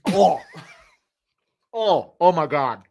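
loud slow retching "oh my God"; gagging. Real, not acted. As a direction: gag on the taste. After sip of foul drink.

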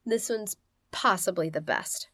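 ordinary delivery

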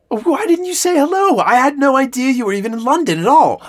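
high, loud and effusive; astonished, theatrical reproach; surprised exclamation. Acted. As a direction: gush at a visitor who never said hello. Delighted surprise he saw the show.

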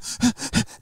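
quick unvoiced gasp, showing shallow breathing; gasping breaths. Shown as a demonstration. Dad showing his daughter's hyperventilating breath, gasping. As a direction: demo a panicked little gasp. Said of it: "the shallow breathing".

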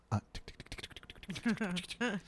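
soft unvoiced tick-tick-tick like typing; imitated keyboard clicks. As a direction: click your tongue like a clerk typing. Clerk typing, imitated keyboard ticks.

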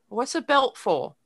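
lightly imitated little girl's British accent; mock British accent, asking a question; thick British accent. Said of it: "She had a very thick accent".

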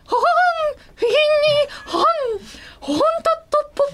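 high, yappy little dog barks; cartoon dog. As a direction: bark the words like a small dog. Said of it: "犬の声でいきます".

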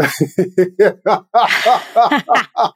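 rhythmic ha-ha laugh tailing off; demonstrated laughter. Shown as a demonstration. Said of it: "and laugh it out".

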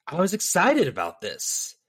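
imitated line with EXCITED swooping up high; fluctuating voice. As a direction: let "excited" leap up, then drop away on "about this". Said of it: "the fluctuation of his voice".

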